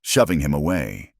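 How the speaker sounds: ordinary delivery, nothing marked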